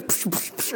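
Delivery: voiceless "psh" stabbing noises; shovel stab noises. Retold or imitated. Son imitates mom stabbing the snake, with breathy psh noises. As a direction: spit out breathy shovel-strike sounds.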